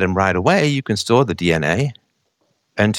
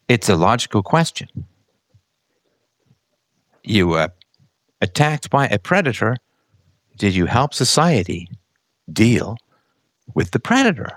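usual speaking voice

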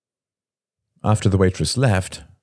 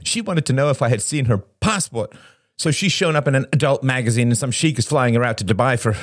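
high, agitated rant; worked-up, higher-pitched tirade; voice risen; 9 semitones higher than usual. A narrator's reading. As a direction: rant on over everyone, pitched up. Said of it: "his voice rose".

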